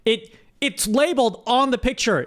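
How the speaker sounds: normal talking voice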